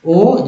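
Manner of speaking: ओ as a lone long "oh"; O sound shown. Shown as a demonstration. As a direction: sound out ओ for learners. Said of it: "ओ W को ओ उच्चारण हुआ".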